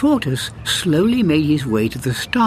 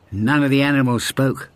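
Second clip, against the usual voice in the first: usual speaking voice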